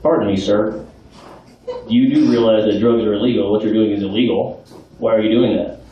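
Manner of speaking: stern police officer. Pretend. Police officer questioning an old man smoking in the street, low and stern. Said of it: "it might go a little something like this".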